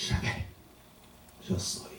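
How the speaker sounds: very soft, low-key reply; hushed calm answer. Acted. Jesus answers the guards who arrest him, very soft and calm. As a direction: answer almost under your breath.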